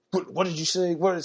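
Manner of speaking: sharp, high mock cop disbelief; incredulous cop. Cop, stunned he refused ID, snaps back in disbelief.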